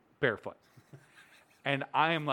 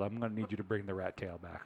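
ordinary talk, nothing marked

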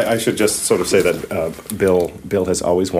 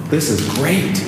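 awed; 3 semitones higher, 4 dB quieter, much slower than usual. Real, not acted. Awed on entering the hidden stairwell.

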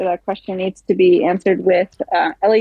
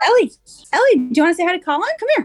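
parent on speakerphone; 9 semitones higher, much faster than usual. Real, not acted. Mother coaxing toddler on speaker.

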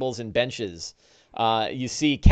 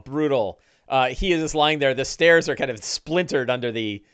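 ordinary delivery

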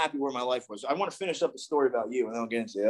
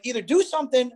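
serious plea; 6 semitones higher than usual. Retold or imitated. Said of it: "and I was serious".

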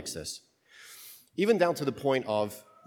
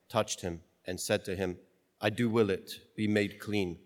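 measured, reverent liturgical reading; solemn gospel reading; 4 semitones lower, 4 dB quieter than usual. Acted.

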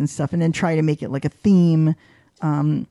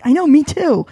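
higher and louder, delighted; gleeful agreement; 10 semitones higher, 7 dB louder than usual. Retold or imitated. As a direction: brag happily that you loved it too. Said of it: "I'm like".

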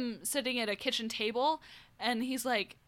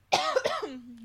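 harsh cough between words; real cough breaking off the sentence; coughing fit; 8 semitones higher than usual. Real, not acted. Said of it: "I'm really sorry that I'm coughing so much".

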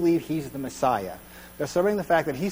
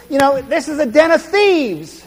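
upset Jesus; 9 semitones higher, 6 dB louder than usual. Retold or imitated. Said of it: "Jesus is upset with this".

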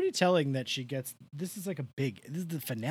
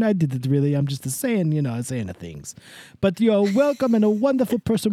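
Italian host voice; 6 semitones higher, 3 dB louder than usual. Acted. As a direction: play a gushing Italian greeter. Host character greeting new members.

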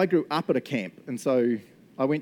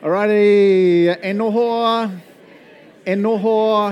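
slow, higher, loud drawn-out call; 7 semitones higher, 12 dB louder, much slower than usual. Real, not acted. Pastor calling the congregation to sit back down, loud and drawn out. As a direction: stretch it out to bring the room back.

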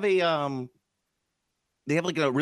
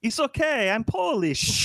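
put-on high-pitched comic Polish accent; 11 semitones higher, slower than usual. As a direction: cheerfully overdo a fake Polish accent. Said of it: "my Polish accent sounds nothing like".

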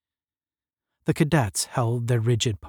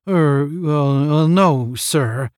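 louder, halting stammer; flustered; 3 semitones higher, 6 dB louder, much slower than usual. A narrator's reading. Cadet caught out by the captain answers, flustered. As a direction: fumble through drawn-out fillers. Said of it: "his face clouding over".

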